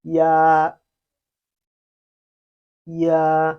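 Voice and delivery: loud, held ya, glide into a; Chinese ya. Shown as a demonstration. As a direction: model ya slowly for the class. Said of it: "the E sound must be read before".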